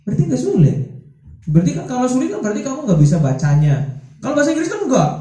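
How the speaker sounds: ordinary talk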